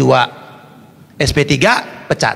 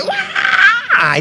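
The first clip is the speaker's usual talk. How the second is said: put-on very high drawn-out child's wail; mock rebellious crying shriek; imitated tantrum wail. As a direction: imitate a child bawling in protest. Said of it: "lalu dia mulai".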